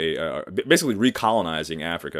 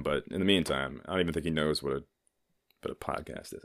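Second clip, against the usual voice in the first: ordinary delivery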